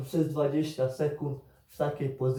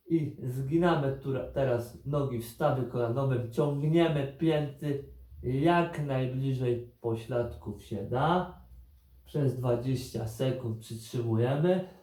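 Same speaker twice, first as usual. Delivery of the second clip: normal talking voice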